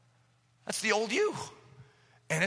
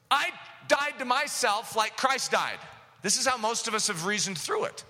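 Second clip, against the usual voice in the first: ordinary delivery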